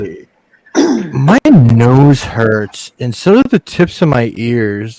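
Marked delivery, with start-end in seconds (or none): 1.1-5.0 slow, drawn-out whine about ailments; mock hypochondriac complaint. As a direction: imitate a worried hypochondriac, pitch leaping up. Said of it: "Cal's a bit of a hypochondriac".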